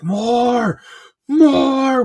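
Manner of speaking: high, loud roar of a battle cry; drawn-out, high-pitched mock yell; imitated screaming. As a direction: scream it like a commander ordering fire. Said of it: "he's just screaming".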